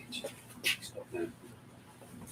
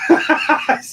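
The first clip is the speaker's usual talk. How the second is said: laughing; 12 dB louder than usual. Real, not acted. Laughing at own suggestion.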